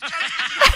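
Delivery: real high-pitched laughter; high burst of laughter. Real, not acted. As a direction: lose it at the joke. Host cracks up at bit.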